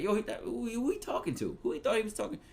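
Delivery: who does he think he is, Philly style; Philly talk. Retold or imitated. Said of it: "we say stuff like that".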